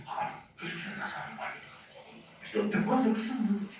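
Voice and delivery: hushed, whispery gossip; murmuring. Acted. Townsfolk murmuring about Jesus of Nazareth, hushed gossip. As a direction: pass it along as whispered rumour. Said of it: "en las murmuraciones decían".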